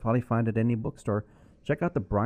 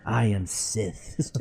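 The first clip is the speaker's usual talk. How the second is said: slow Sith villain; much slower than usual. Pretend. Dark Star Wars villain line.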